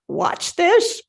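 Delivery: a lightly voiced old woman showing off; sly old woman. Old woman teasing Margaret before drinking the potion, lightly voiced.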